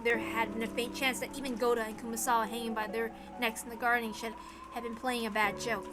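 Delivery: normal talking voice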